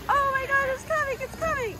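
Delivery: high, thrilled cheering; excited cheering. Real, not acted. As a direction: cheer the boat on. Cheering on a racing boat.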